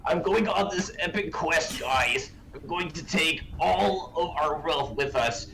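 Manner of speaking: high-pitched imitation of a reckless gambler; hammed-up epic quest declaration; mock heroic boast. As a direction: announce a grand plan with exaggerated bravado. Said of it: "and was like".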